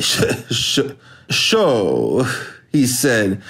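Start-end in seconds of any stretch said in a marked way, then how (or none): 0.0-2.3 breaking into a laugh on the words. A narrator's reading. Bouncer laughing at the big man, sputtering. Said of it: "The bouncer laughed suddenly".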